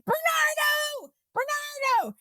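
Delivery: high, slow drawn-out call of his name. Retold or imitated. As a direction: hail him from across the gate, stretching it. Calling flight attendant by name.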